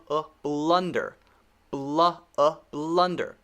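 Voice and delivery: blunder with a clear uh, falling at the end; short U. Shown as a demonstration. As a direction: isolate uh, then land on blunder. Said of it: "short U".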